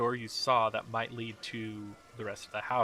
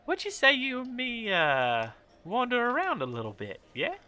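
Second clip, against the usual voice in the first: high, sing-song flirtatious lady; flirty lady; 13 semitones higher, 5 dB louder than usual. Acted. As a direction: purr it like a coquette inviting trouble.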